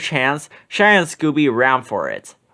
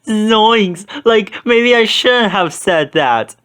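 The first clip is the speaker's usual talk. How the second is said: higher, louder, slow dismay; Shaggy crying out; 7 semitones higher, 4 dB louder, slower than usual. A narrator's reading. Shaggy after Scooby falls, higher louder dismay. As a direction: wail it in regret. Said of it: "cried Shaggy".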